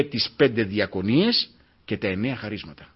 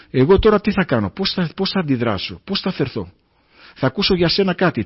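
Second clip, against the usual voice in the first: loud, rising sermon delivery; fiery preaching; 6 dB louder than usual. Real, not acted. The preacher warns the brothers of God's test, loud. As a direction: drive it home to the congregation.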